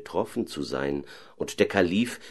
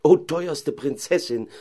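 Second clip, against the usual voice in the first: higher, slow, pleading call; eager call; 9 semitones higher, 3 dB louder than usual. Acted. Caliph eagerly calls to the owl princess, high pleading cry. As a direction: cry out to her, full of hope. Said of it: "rief der Kalif".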